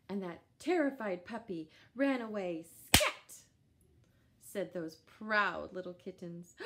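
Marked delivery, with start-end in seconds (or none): 2.6-3.4 excited exclamation. A narrator's reading. Puppy chased away.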